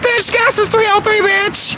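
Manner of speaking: squealed way up high; delighted surprise. Real, not acted. Spotting cheap gas on the road, a high delighted squeal. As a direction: shriek with joy at the price.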